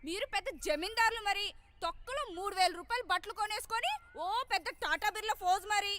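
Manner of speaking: indignant, sarcastic outburst; angry cursing rant. Acted. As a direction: hurl scornful insults at an absent rival. Cursing the rival alone.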